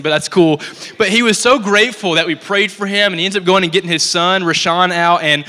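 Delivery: ordinary talk, nothing marked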